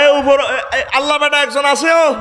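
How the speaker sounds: high, laughing mock shout; mock the careless speakers, hamming it up; mocking imitation. The preacher mocks people who call God a man, laughing and shouting. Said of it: "অনেকে কয় না".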